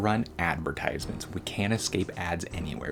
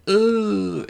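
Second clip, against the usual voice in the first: mock pained grunt, high and loud; hammed-up knocked-out grunt; 8 dB louder than usual. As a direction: grunt like a beaten minotaur. Minotaur knocked down, acted grunt.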